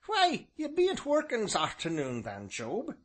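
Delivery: high old voice, mock-solemn; solemn old villager. A narrator's reading. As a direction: ask with sly solemnity. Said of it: "he inquired solemnly".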